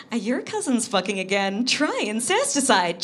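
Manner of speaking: high, loud infomercial pitch; mock advert. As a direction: sell it like a spoof commercial.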